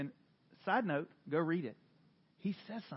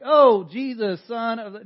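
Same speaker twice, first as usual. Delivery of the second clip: loud, slow mock cry to Jesus; 4 semitones higher, 16 dB louder than usual. Preacher imitating the crazy man, loud slow cry. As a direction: ham up the crazy man on the ground. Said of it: "was like".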